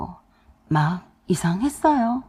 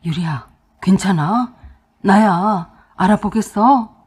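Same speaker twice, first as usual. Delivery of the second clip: emotional, slowing and growing louder; overcome with relief; 2 semitones lower, 5 dB louder than usual. A narrator's reading. Husband to wife opening her eyes, overcome. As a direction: ask anxiously, touched that they woke. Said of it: "감격해서 어쩔 줄 몰라 했습니다".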